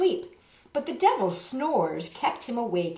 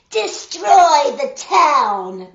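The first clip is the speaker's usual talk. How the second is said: much louder, higher, slow commanding cry; cried out; 7 semitones higher, 14 dB louder, much slower than usual. A narrator's reading. Devil commanding the four winds to destroy the town. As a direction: bellow the order at the winds. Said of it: "the devil cried".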